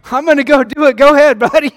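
put-on high, loud, cheeky little boy taunting; defiant kid voice. Preacher voicing his defiant son, cheeky loud child voice. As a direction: play the four-year-old daring dad, laughing. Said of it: "he went just like this".

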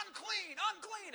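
high, loud call repeated; crying out unclean. Retold or imitated. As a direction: call out a warning, high and strained. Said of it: "they had to say".

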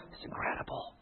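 hushed, breathless whisper of wonder; a barely voiced gasp of awe; awed whisper. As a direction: whisper it like a treasure. Awed at sample spoon, hushed.

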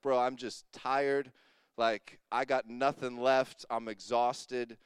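low, soft and slow, worn out; weary confession. Retold or imitated. Pastor tells a friend on the phone he's spent, soft and slow. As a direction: let it sag with tiredness.

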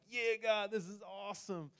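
high, bright-voiced hammed-up joy; mock excitement. Pastor replaying his thrilled prayer, high and eager. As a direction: act out gushing to God in prayer. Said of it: "I'm like".

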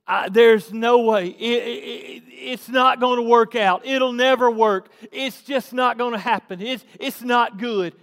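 loud, insistent acted griping; mock complaining. Preacher voicing Israelites who see no way out, mock complaining. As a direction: pile up the complaints like a hammed-up defeatist. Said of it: "are sitting here complaining".

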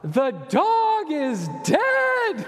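put-on high, slow wailing child's cry; daughter crying out. Imitating his daughter calling out that the dog died, high, slow wail. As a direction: wail it like a heartbroken little girl. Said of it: "And she calls out".

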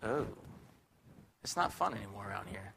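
low, soft, deflated moan; mopey sigh. Retold or imitated. Preacher voicing a depressed viewer, low and deflated. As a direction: sound let down by life. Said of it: "You could literally become".

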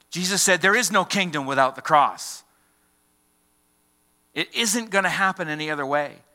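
loud, high, driving preacher's voice; fervent preacher thundering to the congregation; full-force preaching. Acted. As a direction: pound the point home from the pulpit.

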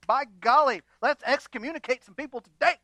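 mock eager zealot. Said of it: "You're like".

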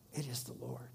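very soft, low near-whisper; hushed awe. Real, not acted. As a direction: let it drop to a reverent hush. Hushed climax of the sermon point.

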